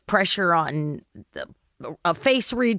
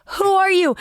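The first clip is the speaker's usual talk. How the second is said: mock startled; 12 semitones higher, 4 dB louder than usual. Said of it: "She's like".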